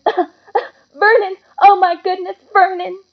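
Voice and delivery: high, loud panicked wife's wail; a wife horrified by the letter; shocked choking cry. A narrator's reading. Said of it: "made a choking noise".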